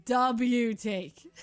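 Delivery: loud mock quote. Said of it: "He says".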